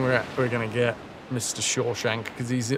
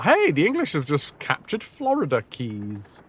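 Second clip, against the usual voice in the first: normal talking voice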